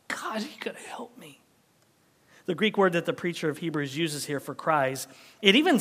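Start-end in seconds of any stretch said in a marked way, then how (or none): 0.1-1.4 whispered cry to God, breathy and pleading; desperate whisper. Retold or imitated. Preacher whispering a desperate prayer to God.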